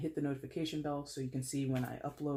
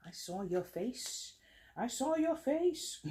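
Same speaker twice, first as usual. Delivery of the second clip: put-on slow, playful Aussie accent, rising in pitch; mock Australian accent; 9 semitones higher, slower than usual. Reader teasing a card that appeared, mock Australian accent. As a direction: sing it up high in an amateur Australian accent. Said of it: "if that's a really good Australian accent".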